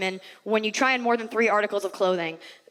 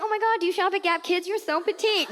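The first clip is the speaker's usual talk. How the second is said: put-on pitched-up girly gush; mock gushing, higher; 7 semitones higher than usual. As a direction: coo over how petite she is. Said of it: "it's like".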